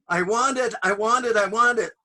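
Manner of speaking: put-on high, loud demanding kid; whiny child. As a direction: beg like a spoiled child.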